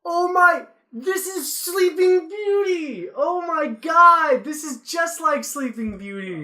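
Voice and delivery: high, loud, drawn-out gushing; mock amazed moviegoer. Imagined theater audience spotting Sleeping Beauty, mock amazed squeal. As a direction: squeal it slowly in hammed-up astonishment. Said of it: "if anybody in the theater was like".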